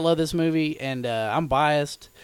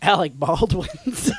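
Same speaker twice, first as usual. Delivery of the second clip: laughing; 6 semitones higher, 3 dB louder, slower than usual. Real, not acted. Punchline joke.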